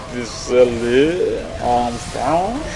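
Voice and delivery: very slow, drawn-out mock French accent. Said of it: "I promise that was a French accent".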